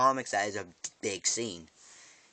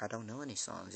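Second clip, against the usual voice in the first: put-on timid kid; 10 dB quieter, faster than usual. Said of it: "He's like".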